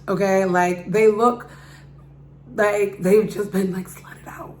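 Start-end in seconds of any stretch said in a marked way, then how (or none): 3.7-4.6 whispered aside. Real, not acted. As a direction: drop to a breathy whisper. Hushed remark about worn-out girls.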